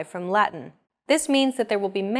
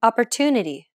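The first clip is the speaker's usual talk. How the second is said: Opportunity, "tu" held longer than the rest; stressed syllable. Shown as a demonstration. Teacher repeating Opportunity with the "tu" stretched. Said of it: "Listen to it again".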